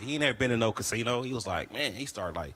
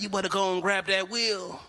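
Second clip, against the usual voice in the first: a higher mock voice of a pushy pimp; bossy pimp; 8 semitones higher than usual. Pimp ordering his girls to drive, mock bossy.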